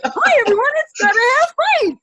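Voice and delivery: very high, loud greeting; hammed-up overexcited intro; excited self-parody. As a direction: squeal a hello to your followers. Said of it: "I get excited".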